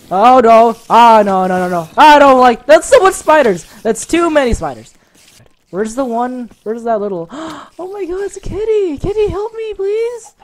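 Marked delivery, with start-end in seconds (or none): 0.1-4.9 loud, high frantic protest; panicked. Real, not acted. Swarmed by spiders. 7.3-10.3 very high squeaky plea to the kitty; high pleading squeal. Real, not acted. Sees a cat amid spiders.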